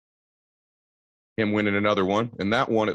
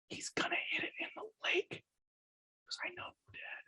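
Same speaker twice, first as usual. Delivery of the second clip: whispered prediction, barely audible; 17 dB quieter than usual. Retold or imitated. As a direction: whisper it like a secret. Son whispers prediction back.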